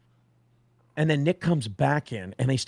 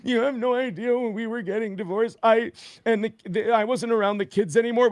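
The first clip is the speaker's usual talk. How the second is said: higher, whiny mock voice of April; mimicking April; 8 semitones higher than usual. Mocking April's excuse about the kids, in a higher whiny voice. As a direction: put on a whiny imitation. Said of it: "April's like".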